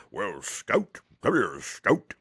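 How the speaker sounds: a light, gentle Southern lawyer calling his daughter; a fatherly small-town lawyer voice, only lightly put on; Atticus Finch. Show host slips into a quick, lightly voiced Atticus Finch. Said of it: "Atticus Finch impersonation".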